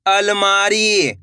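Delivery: अलमारी slow, syllables stretched; blended syllable reading. Shown as a demonstration. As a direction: draw out अलमारी for learners. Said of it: "मिलके क्या बनेगा?". (अलमारी को धीरे से खींचकर)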